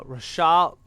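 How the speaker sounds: slow, halting stab at a French accent; attempted French accent. Shown as a demonstration. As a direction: try the name in French, drawn out and rising. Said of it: "say it with a French accent".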